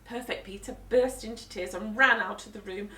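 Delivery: ordinary delivery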